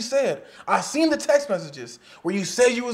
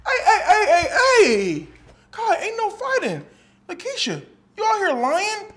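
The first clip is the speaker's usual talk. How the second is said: breaking up a fight; 11 semitones higher, 4 dB louder than usual. Acted.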